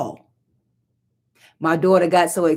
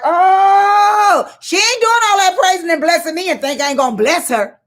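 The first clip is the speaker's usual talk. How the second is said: pleased king; 13 semitones higher, 4 dB louder than usual. Retold or imitated. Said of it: "a king is like".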